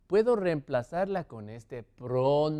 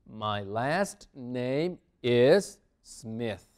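very slow, word by word; deliberate, drawn-out language-teacher answer; slow model answer; 4 semitones lower, much slower than usual. Shown as a demonstration. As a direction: leave space after each word for learners.

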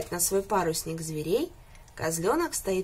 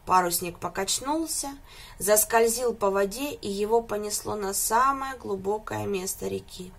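ordinary delivery

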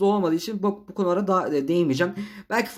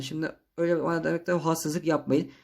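normal talking voice

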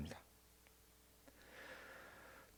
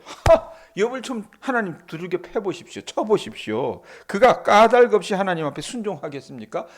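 Satan retorting; 5 semitones higher than usual. Acted. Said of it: "사탄이 뭘 맞받아쳐요".

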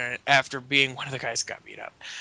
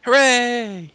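cheering; 8 semitones higher, 6 dB louder than usual. Real, not acted. Cheering for Joseph Park.

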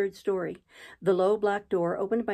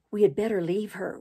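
ordinary delivery

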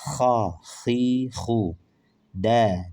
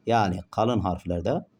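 ordinary talk, nothing marked

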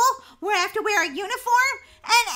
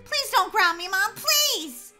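higher-pitched begging that builds on the last please; desperate pleading; 5 semitones higher, 5 dB louder, slower than usual. Acted. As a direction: plead with Mom, voice climbing. Begs mother not to ground her.

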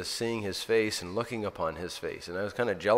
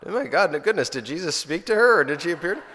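higher and louder, startled disbelief; astonished thought; 9 semitones higher, 8 dB louder than usual. Retold or imitated. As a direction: react as if floored by the news. His own astonished reaction.

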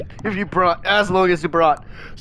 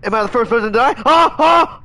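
high, loud rant of self-pity; real loud gamer meltdown; frustrated yelling; 8 semitones higher, 6 dB louder than usual. Real, not acted. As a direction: yell that it always happens to you. First to die again, raging.

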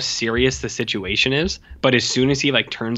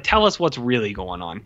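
normal talking voice

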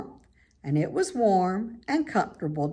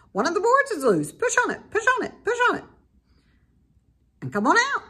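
much higher, louder, rapid goose voice; fast honking goose; 13 semitones higher, 5 dB louder, much faster than usual. A narrator's reading. Goose telling Wilbur to push the loose board, fast and high. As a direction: honk it out fast and excited. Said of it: "who talked rather fast".

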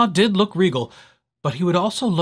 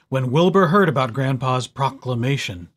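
plain reading voice

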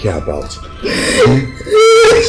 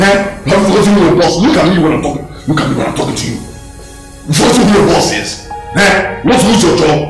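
raised, threatening voice; indignant, forceful dressing-down; angry boss; 4 semitones higher, 3 dB louder than usual. Acted. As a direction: pull rank loudly on an employee. Boss angrily threatens insolent employee.

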